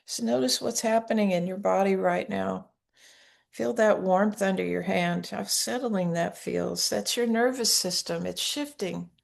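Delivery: soft, unhurried meditation guidance; guided meditation. Acted. Teacher to listeners with hands on hearts, soft guided meditation. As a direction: guide them gently into calm and safety.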